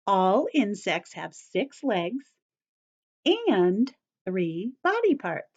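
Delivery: slow, deliberate rule. Shown as a demonstration. Teacher repeats key rule.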